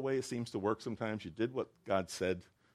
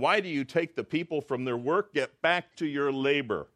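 stern Pharaoh rebuke; 6 semitones higher, 7 dB louder than usual. Retold or imitated. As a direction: bark it like a haughty king. Pharaoh rebukes Moses, quoted.

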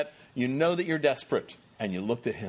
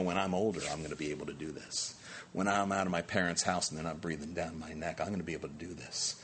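low, hushed, secretive voice, much softer; quiet scheming; 11 semitones lower, 9 dB quieter than usual. Retold or imitated. Youth quietly dreaming of life after parents' house. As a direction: murmur it like a secret plan. Young person dreaming of freedom.

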